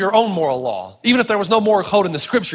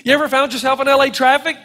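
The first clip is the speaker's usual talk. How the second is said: normal talking voice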